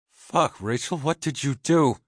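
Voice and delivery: high, loud, shocked cry; panicked outburst. A narrator's reading. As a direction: blurt it in horror. Sister just shot husband.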